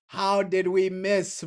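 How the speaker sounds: normal talking voice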